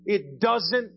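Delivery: high, drawn-out exasperated outburst; emphatic exasperation. Real, not acted. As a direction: stretch each word out, pitched high, to dismiss it.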